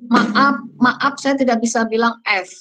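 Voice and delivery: put-on light Sundanese accent, "Maaf" ending in p, maap; imitating a Sundanese speaker who turns F into P; mock Sundanese accent. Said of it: "Seperti orang Sunda ya".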